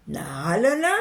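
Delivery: ordinary delivery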